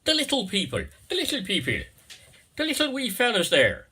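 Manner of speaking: high, lilting Irish little-people voice; Irish accent. Pretend. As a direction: play a wee Irish leprechaun. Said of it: "forgive the Irish".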